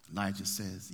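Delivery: ordinary talk, nothing marked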